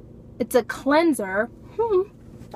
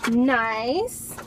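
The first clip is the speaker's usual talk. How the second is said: usual speaking voice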